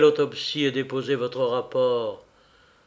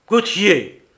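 louder, higher outcry; sudden cry of the name; exclamation; 5 semitones higher, 7 dB louder than usual. A narrator's reading. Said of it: "S'écria Marignan".

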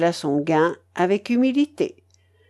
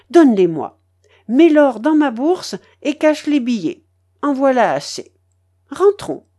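satisfied commands; 4 semitones higher, 5 dB louder than usual. A narrator's reading. Grandmother cashing out.

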